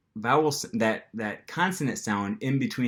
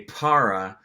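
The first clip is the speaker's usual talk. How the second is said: "para" with an American English R; non-native gringo accent on "para"; 4 dB louder than usual. Shown as a demonstration. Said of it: "gringo accent or non-native accent".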